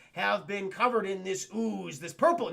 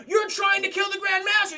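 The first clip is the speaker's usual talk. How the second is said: higher, louder angry yell; guard's accusing shout; 11 semitones higher, 7 dB louder than usual. Retold or imitated. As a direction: bellow the accusation at him. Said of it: "And they go".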